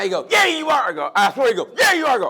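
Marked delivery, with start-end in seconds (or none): 0.3-0.9 high-pitched mock insistence; insistent retort. Cab driver contradicting the passenger, mock insistent. Cabbie insisting in retold argument. 1.8-2.2 high-pitched mock insistence; insistent retort. Cab driver contradicting the passenger, mock insistent. Cabbie insisting in retold argument.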